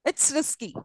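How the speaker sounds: high falling tone on "risky". Shown as a demonstration. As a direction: lift "risky" and let it fall away. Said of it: "high fall".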